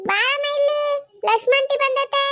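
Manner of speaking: put-on high boyish call; the little son shouting for mum; boy calling his mother because the aunty has come; young boy.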